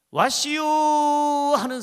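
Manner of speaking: drawn-out, high Chungcheong dialect call. Retold or imitated. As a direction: play up the slow Chungcheong drawl. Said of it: "그 충청도 사람". (충청도 사투리로 높게 부르는 말)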